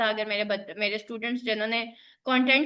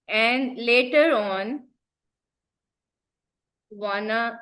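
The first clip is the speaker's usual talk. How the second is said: very slow, word by word with long gaps; dictating while typing; 3 semitones lower, much slower than usual. Real, not acted. Teacher typing a prompt aloud for students, slow dictation. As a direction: spell out the request one word at a time.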